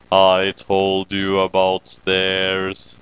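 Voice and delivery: a deep deadpan critter gloating; low smug creature saying I told you so; smug Kyubey voice. Acted. Kyubey voice again.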